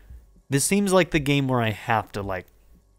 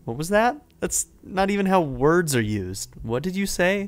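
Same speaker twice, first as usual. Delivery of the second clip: higher, lively read with an amused woman's lines; animated story narration; 7 semitones higher, 3 dB louder than usual. Acted. Streamer voices a woman erupting in laughter, animated and high. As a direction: perform the scene with incredulous delight.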